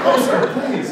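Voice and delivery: a giddy, embarrassed brush-off; overwhelmed laughing plea; flustered laugh. Retold or imitated. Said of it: "you're just like".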